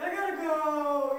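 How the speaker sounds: hammed-up phone sign-off; abrupt sign-off. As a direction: cut the moaning short to go out. Suddenly leaving call for roommate.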